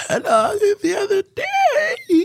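very high, slow, strained croak; bad sick voice. Host showing co-host how bad yesterday's voice was, strained high squeal. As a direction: squeal it out slowly like a wrecked voice. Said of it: "I was talking like this".

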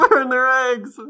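laughing through the words. Real, not acted. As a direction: burst out laughing while repeating it. Laughing repeat of friend's line.

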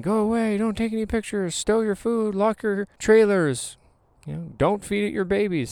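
high, bossy barked orders; mock yelling host. Vlogger imitating the host ordering campers around, high and bossy. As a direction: play the jerky campground host. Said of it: "starts yelling".

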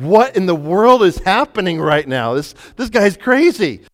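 a stunned inner voice watching chaos; astonished thought. Retold or imitated.

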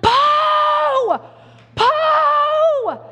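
long, high holler for a runaway dog; screaming the name. Acted. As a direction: bellow it out of a car window. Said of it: "screaming his name out of a window".

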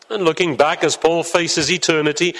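ordinary talk

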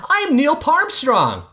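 put-on boy announcing himself in a spacesuit; kid shouting. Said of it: "he shouted".

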